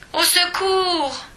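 high, slow call for help; a drawn-out high plea; cry for help. A narrator's reading. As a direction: wail it into the dark. Falls into darkness.

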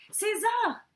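high, loud call of warning; alarmed cry. A narrator's reading. Father calls his son back from the window, crying out. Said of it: "s'écria son père".

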